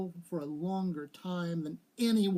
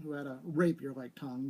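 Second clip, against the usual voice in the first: plain reading voice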